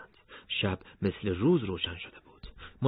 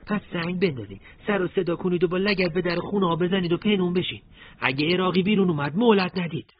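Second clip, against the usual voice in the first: commander giving orders; 10 semitones higher, 5 dB louder than usual. Acted. Morteza gives attack orders.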